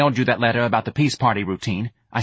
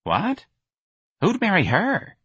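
much higher incredulous outburst; astonished Myrna; 11 semitones higher, slower than usual. Acted. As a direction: gasp it out in disbelief.

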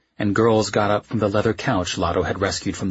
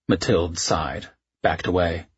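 plain reading voice

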